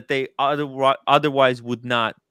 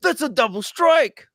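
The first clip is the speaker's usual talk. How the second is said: mock complainer; 11 semitones higher than usual. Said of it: "Lord is gonna be like".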